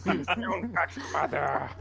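slow, hammed-up yell of "Mother"; mock shout. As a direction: bellow "Mother" like a crazed villain. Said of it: "chime in every once in a while and go".

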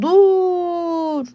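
long drawn-out, high-pitched shouts; stretched high call from a distance. A narrator's reading. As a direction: holler to stop someone far away. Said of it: "diye bağırıyorlar".

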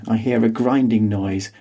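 usual speaking voice

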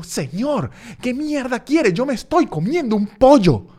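the cry of a man who just wants to eat his chicken; desperate shout. Retold or imitated. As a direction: snap at him at the end of your rope. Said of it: "Ese fue mi grito de desesperación".

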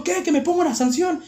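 high, defiant challenge; retold yell. Student daring teacher to punish him, high defiant yell. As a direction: throw it back high and cheeky. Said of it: "le grité".

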